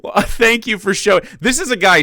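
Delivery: big high laugh, loud. Real, not acted. Streamer laughing at the clip, then mock thanks.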